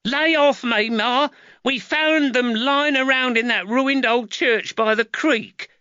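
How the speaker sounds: put-on protesting boy. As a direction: whine back at your mother. Nathaniel protesting.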